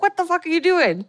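high, sharp furious yell of a father; angry dad. Retold or imitated. Father catching his son sneaking out, furious. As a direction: snap at the kid you just caught.

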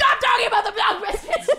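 high, loud comic outburst; mock exasperated shout. Shouting down the dog biscuit joke, mock exasperated.

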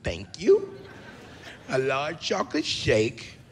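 slow, drawn-out fussy order; savoring fast-food customer. Acted. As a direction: order it slowly, relishing each item.

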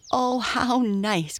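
put-on high, drawn-out girlish delight; delighted cry. A narrator's reading. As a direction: exclaim with slow, high glee. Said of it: "cried Mabel".

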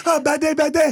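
excitable mock movie-star voice; Jackie Chan. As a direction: cry out in a hammed-up Hong Kong accent. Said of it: "playing young Jackie Chan or something".

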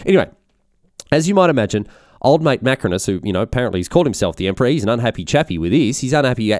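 ordinary delivery

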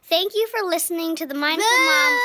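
loud. Pretend. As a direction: shout it through giggles. Child shouting podcast thanks.

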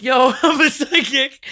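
loud, high, drawn-out announcement through laughter; spunky. Retold or imitated. Retelling an elevator approach to a celebrity, spunky and loud. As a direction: blurt it out slowly and boldly, laughing. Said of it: "I'm feeling spunk".

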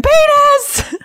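high, gleeful mock shout of a little boy; kid yelling. Friend imagining the little boy shouting in kindergarten, high gleeful yell. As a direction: shout it like a kindergartner showing off a new word. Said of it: "running around kindergarten going".

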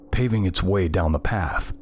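ordinary delivery

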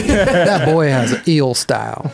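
slow, laughing tease. Real, not acted. Teasing pun, laughing.

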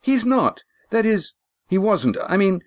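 high, halting confusion; confused faltering. Acted. Lindy losing her thread, high and faltering. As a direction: stumble over the words. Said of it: "Lindy's voice faded in uncharacteristic confusion".

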